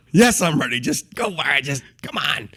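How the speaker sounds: mock tough guy urging him on; impatient bravado. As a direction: act out bracing for a punch. Acts impatient self before punch.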